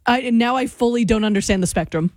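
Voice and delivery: usual speaking voice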